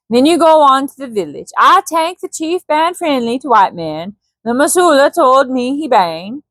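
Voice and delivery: Swedish sailor's broken English; Swedish accent. Acted. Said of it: "didn't do his accent".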